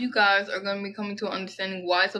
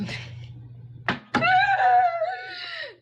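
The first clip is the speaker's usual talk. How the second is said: very high, held squealing laugh. Real, not acted. Reader squeals at thinking of other things, high squeal. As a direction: let out a piercing, drawn-out squeal. Squeals at her own thought.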